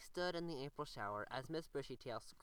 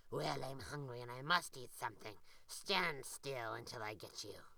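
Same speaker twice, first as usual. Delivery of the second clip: growling gator; 5 semitones lower than usual. A narrator's reading. The gator threatens the rabbit, low and growling. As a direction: growl it with hungry menace. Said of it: "The gator growled".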